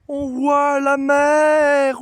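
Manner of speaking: slow, high, loud delight; thrilled at seeing the sea; excited. Real, not acted. First sight of the sea.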